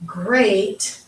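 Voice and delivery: usual speaking voice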